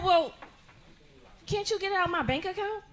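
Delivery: put-on high, fast little-girl plea; a kid wheedling mom for her money; mimicking her daughter. Comedian mimics her daughter begging at Target, high and fast.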